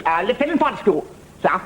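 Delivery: hint of an upper-class young subaltern; young Sandhurst lieutenant. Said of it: "a young gentleman just finished in Sandhurst".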